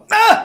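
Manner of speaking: sudden high, loud cry of shock; startled yell. Retold or imitated. Man jolted by a flashback of last night, startled yell. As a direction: jolt awake with a yelp.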